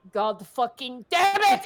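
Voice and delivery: loud, drawn-out angry curse; exasperated shout. Acted. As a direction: curse loudly, slow and building. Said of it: "she goes".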